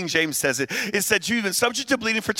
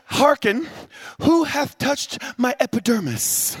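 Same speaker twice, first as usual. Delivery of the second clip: mock British accent; 4 semitones higher than usual. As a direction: put on a pompous British accent. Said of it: "he speaks with a British accent".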